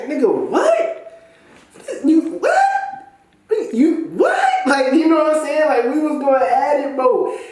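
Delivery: very high, laughing disbelief; incredulous laughing squeal. Retold or imitated. Reliving the fight with his friend, laughing high-pitched disbelief. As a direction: shriek it in mock outrage.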